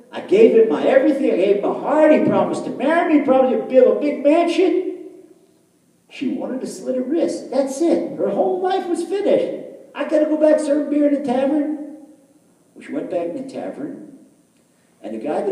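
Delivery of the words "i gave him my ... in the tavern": loud, high-pitched heartbroken outburst. Retold or imitated. Jilted barmaid pouring out her story, heartbroken. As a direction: wail out the betrayal, voice raised. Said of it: "She was heartbroken".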